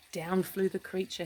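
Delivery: ordinary delivery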